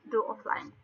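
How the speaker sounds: normal talking voice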